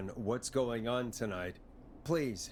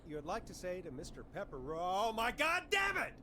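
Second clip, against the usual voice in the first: much higher, loud, laughing fury; exasperated host; 14 dB louder than usual. Acted. [name] losing it at the pizza ad caller, loud and laughing. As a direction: blow up at the prank caller. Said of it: "host exasperated at prank caller".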